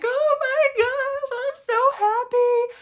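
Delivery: high, loud, slow teary grandma; happy tears. Retold or imitated. Grandma crying over the news, high teary joy. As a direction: cry out with joy, stretched. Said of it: "started crying".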